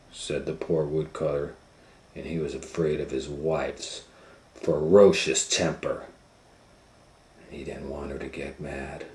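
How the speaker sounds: ordinary delivery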